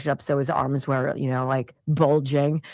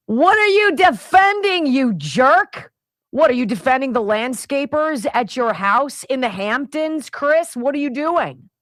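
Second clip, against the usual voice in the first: angry rant; 6 semitones higher than usual. Real, not acted. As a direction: bite into each question, furious. Host berating Chris Cuomo directly.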